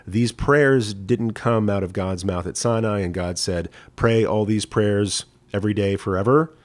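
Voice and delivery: usual speaking voice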